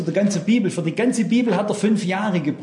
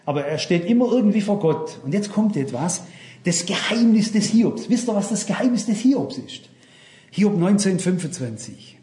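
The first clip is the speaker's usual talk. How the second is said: usual speaking voice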